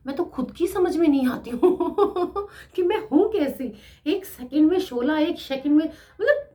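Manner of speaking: high, loud laugh through the words. Real, not acted. Laughing at herself to her audience. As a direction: giggle at yourself.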